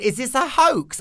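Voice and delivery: a caller who thinks it's a prank; suspicious caller. Retold or imitated. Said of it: "And then someone went".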